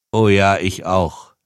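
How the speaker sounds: slow, lightly amused agreement; amused reply. A narrator's reading. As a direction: agree slowly with a touch of amusement. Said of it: "lachte der Mann".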